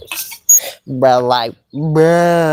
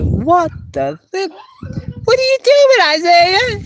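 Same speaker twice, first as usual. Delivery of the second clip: shocked yell; 14 semitones higher than usual. Retold or imitated. Shocked yelling at Isaiah.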